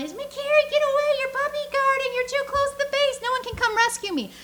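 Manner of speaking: high-pitched kid's mock yell at the base guard; shrill-free but high, playground complaint; yelling kids. Kids trapped in base yelling at Carrie, high mock yell. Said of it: "they're yelling".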